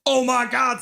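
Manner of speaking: high-pitched fan exclamation; acted astonished cheer; excited disbelief. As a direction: burst out as an amazed fan. Acts out a fan's astonishment.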